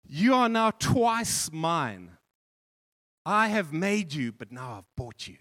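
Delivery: put-on boy speaking to his boat, tender; tender vow. A narrator's reading. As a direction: speak it like a promise.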